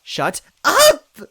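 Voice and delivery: high loud shout; cried out. A narrator's reading. Toadette shouting at the villain, loud cracking cry. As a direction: yell it at her, voice cracking. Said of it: "Toadette cried".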